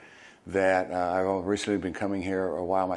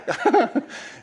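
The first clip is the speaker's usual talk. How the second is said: high laugh; 5 dB louder than usual. Real, not acted. Laughs at own impression.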